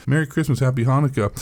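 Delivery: ordinary talk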